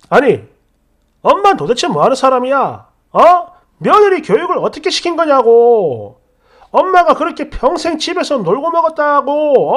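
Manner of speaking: high, loud, fast tirade; angry yelling. Retold or imitated. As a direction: rant at his mother on the phone. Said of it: "이러면서 막 화를 내는 거야". (엄마에게 전화로 버럭 화내며)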